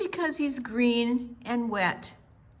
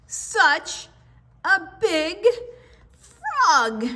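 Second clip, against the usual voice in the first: higher, louder frog chuckling; laughing frog voice; 9 semitones higher, 6 dB louder, much slower than usual. Acted. Grandma's frogs tease the dragon, higher with a laugh. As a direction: croak it out with a laugh.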